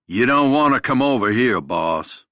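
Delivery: loud, high southern warning at first; a Southern drawl called out of the dark. Acted. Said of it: "a voice says in a southern drawl".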